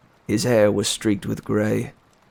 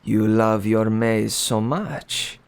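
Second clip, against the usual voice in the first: slow, cold, gloating king; cruel king; slower than usual. Acted. The king gloats over the captured inventor, slow and cold. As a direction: savor every word like a tyrant.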